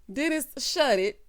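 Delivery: ordinary delivery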